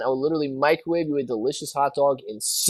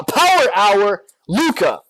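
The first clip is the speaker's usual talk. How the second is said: announcer hype; 5 semitones higher, 5 dB louder, slower than usual. Acted. Stock stream hype call.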